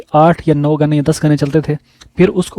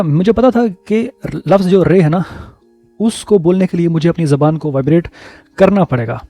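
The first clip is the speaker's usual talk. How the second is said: ordinary delivery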